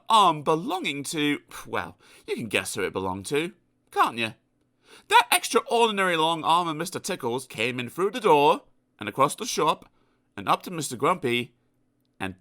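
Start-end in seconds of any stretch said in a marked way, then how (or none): none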